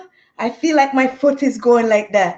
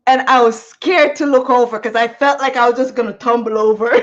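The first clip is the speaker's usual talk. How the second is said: ordinary delivery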